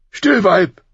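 loud, high snap at his wife; barking king. A narrator's reading. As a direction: cut her off sharply. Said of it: "fuhr er sie an".

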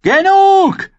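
very high, loud cry; called out. A narrator's reading. Mother cries out over the drum, desperate shout. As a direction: shout to stop the slaughter. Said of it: "rief Caitlin".

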